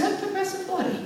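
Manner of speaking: very high imitated disbelief; puzzled husband. Husband reading about wife's night out, mock bafflement. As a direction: question it in a put-on high voice.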